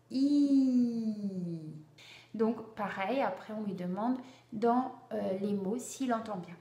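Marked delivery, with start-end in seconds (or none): 0.1-1.6 "I" as French ee, held very long; sustained vowel. Shown as a demonstration. Teacher pairing the I with a raised finger, sustained vowel. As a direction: draw out "I" in one steady glide. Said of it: "Pour le I, l'index en haut".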